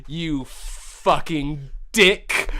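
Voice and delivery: slow, loud, drawn-out curse; hammy slow loud insult. Acted. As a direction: spit it out slowly and loudly. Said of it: "Charles de Gaulle goes".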